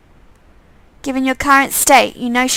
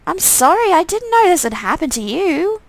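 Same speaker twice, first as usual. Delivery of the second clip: higher, pleading sorry; apologetic; 7 semitones higher than usual. Pretend. Player apologizing to the twin, higher pleading. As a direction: plead with him.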